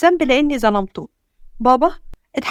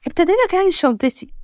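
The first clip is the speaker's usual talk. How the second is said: ordinary delivery, nothing marked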